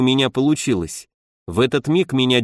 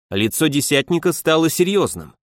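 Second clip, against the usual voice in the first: ordinary delivery, nothing marked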